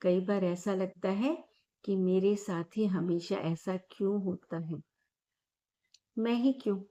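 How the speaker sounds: calm, measured meditation guide with long pauses; guided meditation. Acted. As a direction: lead listeners gently through each breath, leaving space after every phrase. (शांत, ठहराव भरी ध्यान कराने वाली आवाज़)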